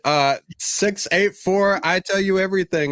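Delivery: high, slow Jamaican TV-psychic impression; terrible mock Jamaican hotline pitch; bad Jamaican accent. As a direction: put on a hammed-up Jamaican accent. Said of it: "A terrible Jamaican accent".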